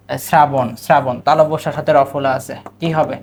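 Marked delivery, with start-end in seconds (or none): none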